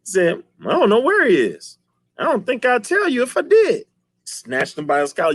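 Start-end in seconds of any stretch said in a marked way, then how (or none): 0.6-3.8 high put-on voice; mock defiant dad. Dad refusing the villain, high mock voice. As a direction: defy him, chin up.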